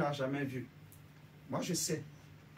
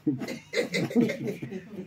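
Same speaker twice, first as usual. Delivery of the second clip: laughing; 2 semitones higher than usual. Real, not acted. Laughs about deacon visiting.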